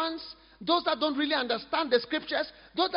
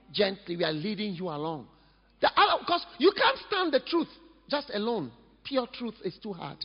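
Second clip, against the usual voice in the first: ordinary delivery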